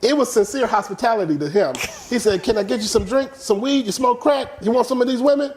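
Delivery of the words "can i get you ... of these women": higher, lightly voiced old man; a hint of an old OG offering; old hustler voice. Uncle Sonny offering hospitality.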